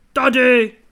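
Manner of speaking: loud bark of a rough voice; shout. A narrator's reading. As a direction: call the policeman over with a shout. Merchant calls the policeman. (hlasitý výkřik)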